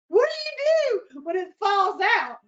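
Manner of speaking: high, loud comic outburst; exasperated exclamation. Real, not acted. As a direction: cry it out in amused mock panic. Cracking up over retold mishap.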